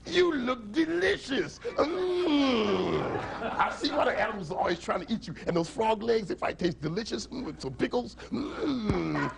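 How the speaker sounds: high, drawn-out gloating with savouring mms; hungry relish. Acted. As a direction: drool over a tasty meal. Lion drools over his friends.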